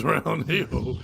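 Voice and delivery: ordinary talk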